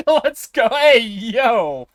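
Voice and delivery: high, loud hype; excited cheer. Real, not acted. Streamer reacting to the stream, loud excited cheer.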